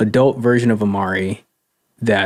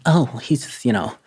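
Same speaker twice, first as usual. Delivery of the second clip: mocking quote; 4 semitones higher than usual. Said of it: "born like".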